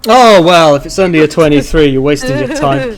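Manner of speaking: loud, playful mock scorn; mock dismissal. Game master teasing a player over a twenty-three, loud mock dismissal. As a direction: tease the player with loud fake disappointment.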